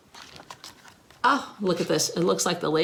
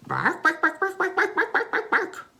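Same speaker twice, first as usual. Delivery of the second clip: rising yelps, higher and higher; excited dog barks climbing up; barking dog; 12 semitones higher than usual. Acted. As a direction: yap like a dog at pigeons. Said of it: "He's doing a lot of barking".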